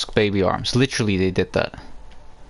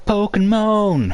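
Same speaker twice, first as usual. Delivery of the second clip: drawn-out high Pokemon cry; shout; 10 semitones higher, 4 dB louder, much slower than usual. Pretend. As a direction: yell Pokemon! slow and high. Shouting the meme answer.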